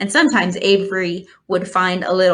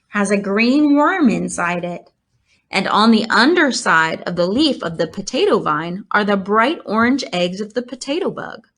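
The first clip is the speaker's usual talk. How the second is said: plain reading voice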